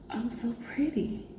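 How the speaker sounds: soft, high, near-whispered awe; reliving a quiet moment of self-admiration; hushed realization. Retold or imitated. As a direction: say it to yourself, barely above a whisper. Retold awed moment at the mirror.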